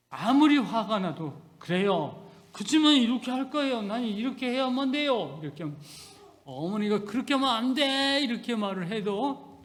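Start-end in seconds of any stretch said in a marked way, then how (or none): 1.6-5.4 firm insistence. Retold or imitated. Son's firm reply voiced. 7.1-8.2 mother forbidding. Retold or imitated. Mother voiced in story.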